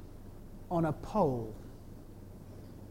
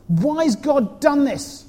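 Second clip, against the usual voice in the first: distressed mother; 8 semitones higher, 7 dB louder, much slower than usual. Acted. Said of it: "said Mother Ruth to her husband".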